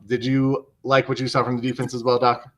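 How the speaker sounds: normal talking voice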